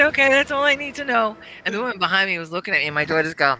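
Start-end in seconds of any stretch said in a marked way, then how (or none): 0.0-1.4 high, laughing acceptance; amused okay. Retold or imitated. Mom accepting the waiter's cow answer, high and amused. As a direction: give in with a higher, laughing okay. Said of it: "I said".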